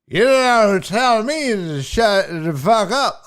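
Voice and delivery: slow, loud, slurred retort; mad drunk heckler. Retold or imitated. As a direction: smear the words in drunken indignation. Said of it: "Now the dude's getting mad".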